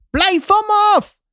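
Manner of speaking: high, drawn-out cry of protest; mother's outcry. A narrator's reading. Mother fighting off two guards, high-pitched outcry. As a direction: cry out high, fending someone off.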